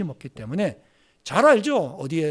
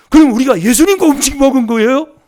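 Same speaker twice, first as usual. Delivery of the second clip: high, loud shocked question; shocked villagers blurting it out; alarmed question; 9 semitones higher, 13 dB louder than usual. Retold or imitated. Villagers realizing they ate Jesus' food, high shocked question.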